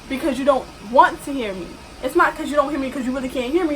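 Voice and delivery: ordinary talk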